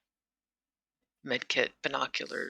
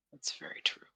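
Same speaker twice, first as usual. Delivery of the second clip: whispered agreement; 10 dB quieter, faster than usual. Real, not acted. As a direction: murmur it under your breath. Agreeing quietly.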